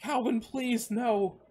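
high, tearful plea; voice cracking. A narrator's reading. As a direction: beg in a small cracking voice. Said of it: "he said softly, his voice cracking".

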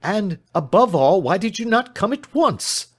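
loud, high reproach; exasperated cry. Acted. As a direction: demand it with rising urgency, loud and high. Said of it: "he cried".

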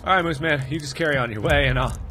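ordinary talk

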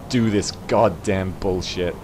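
usual speaking voice

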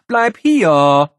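loud call to stop it; shouting after the box. Acted. Boy shouting at his escaping gift to stay. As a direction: yell after something running off.

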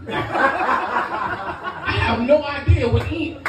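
ordinary talk, nothing marked

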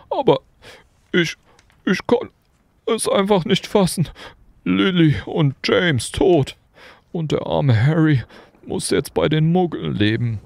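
a giant breaking down in tears; tearful wail. A narrator's reading. Hagrid grieving for Lily and James, tearfully wailing. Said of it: "schluchzte Hagrid".

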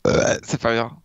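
words through a burp; burping after fizzy drink. Real, not acted. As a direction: talk while burping. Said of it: "tu m'as fait penser en rotant".

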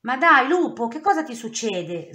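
taken aback by his outburst; she-wolf shocked at the wolf; astonished. A narrator's reading. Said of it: "sbuffò Lupa sbalordita".

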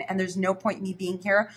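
ordinary talk, nothing marked

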